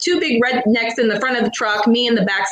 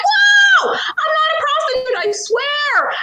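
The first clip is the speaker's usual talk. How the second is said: frantic denial; 14 semitones higher than usual. Retold or imitated. Protesting innocence to the arresting cop, high frantic squeal.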